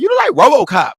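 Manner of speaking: high, loud insisting yell; emphatic shout. Retold or imitated. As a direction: shout it at him, doubling down. Insists after his reply.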